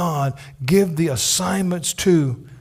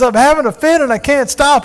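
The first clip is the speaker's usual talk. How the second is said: put-on higher, louder childish whine; Opie whining; 10 semitones higher, 8 dB louder than usual. Opie to Andy mid-tantrum, whiny child voice. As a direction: whine like a spoiled kid. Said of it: "He says".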